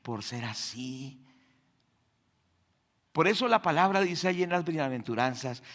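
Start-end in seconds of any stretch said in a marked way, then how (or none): 0.0-1.2 soft, slow, lower prayer for forgiveness; hushed sorrowful prayer; tearful plea. Retold or imitated. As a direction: plead quietly as if near tears. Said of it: "nos va a llevar aún a llorar y a decirle".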